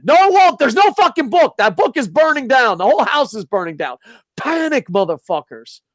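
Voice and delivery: worked-up comic hysteria; panicked outrage. Acted. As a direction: raise the alarm, voice jumping up. Acted panic over burning book.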